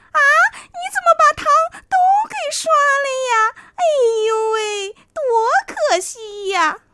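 high, fast wailing fox; fake dismay. A narrator's reading. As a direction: overdo the horror, sly fox. Fox fakes dismay at brushing.

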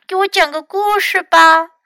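high, loud little-one voice; small child's voice. A narrator's reading. Hurt Louis orders Fox about, high small voice. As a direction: make demands in a high small voice.

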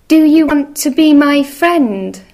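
high, loud eager little mouse. Acted. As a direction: call out brightly like a tiny mouse. Mouse asks each animal.

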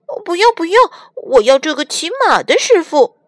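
put-on high child voice, insisting; a spoiled little kid refusing; childish whiny refusal; stubborn little boy. Little boy refuses, wants horseman.

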